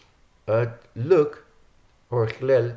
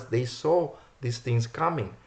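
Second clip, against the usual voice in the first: normal talking voice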